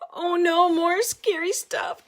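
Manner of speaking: put-on high, loud alarmed little girl's cry; Penelope crying out in alarm at scary stuff; frightened cry. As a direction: squeal it out in fright. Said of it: "cried Penelope".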